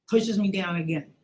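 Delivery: ordinary talk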